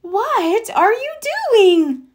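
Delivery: high, startled question; surprised mom. Acted. Mother catches Froggy leaving, high and startled. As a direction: catch him mid-escape.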